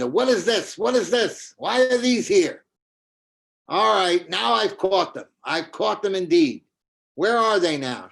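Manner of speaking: high, loud, angry demands; furious accusation. Acted. Kautilya confronting Vrinda Devi with the evidence, high angry shout. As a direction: shout it as if catching a liar red-handed.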